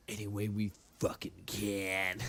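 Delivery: low, soft and grim; a hushed action-hero vow; gruff hero line. Acted. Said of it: "he goes".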